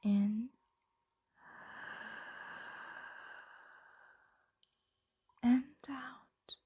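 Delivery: calm ASMR sleep whisper; guided sleep whisper. Acted. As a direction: lull them to sleep, breath by breath. Dragon guides listener to sleep.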